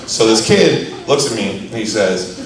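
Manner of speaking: ordinary talk, nothing marked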